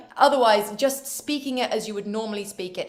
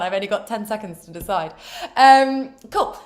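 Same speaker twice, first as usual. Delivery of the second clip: ordinary delivery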